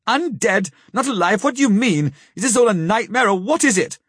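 high, alarmed questioning; horrified. A narrator's reading. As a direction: pitch up in disbelief and dread. Arthur horrified, pressing questions.

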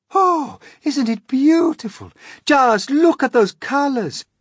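a high, loud, rapt cry; delighted. Acted. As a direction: gasp with delight at the colours. Delighted at the rainbow.

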